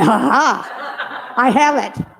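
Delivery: laughing "aha" of victory; retold gleeful eureka; triumphant discovery. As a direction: crow as if the answer just struck. Grandmother finds the motivation.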